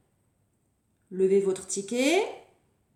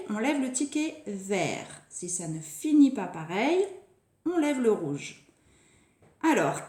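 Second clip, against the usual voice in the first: ordinary talk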